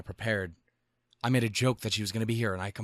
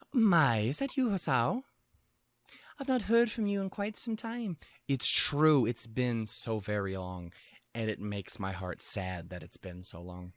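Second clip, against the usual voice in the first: refined Scottish lilt, pitched up; Lily's voice; 2 semitones higher than usual. Acted. Lily greeting a long-absent friend, lilting Scottish voice. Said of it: "I finally found the voice again".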